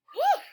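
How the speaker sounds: relieved whew. Real, not acted. Relief after the sentence.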